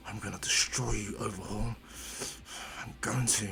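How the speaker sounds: low, hushed whispered vow; inner-thought whisper. Acted. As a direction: whisper the threat privately, as a thought. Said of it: "he keeps in his mind".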